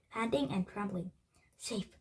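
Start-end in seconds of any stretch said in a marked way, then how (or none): none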